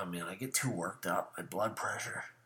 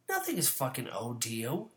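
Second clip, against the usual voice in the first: ordinary talk, nothing marked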